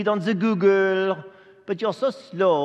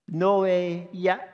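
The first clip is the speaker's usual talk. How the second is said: usual speaking voice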